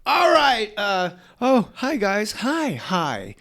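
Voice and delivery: high, sheepish hello; awkward nervous greeting. Retold or imitated. Comedian retelling walking in on [name], high nervous greeting. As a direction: squeak out a nervous hi.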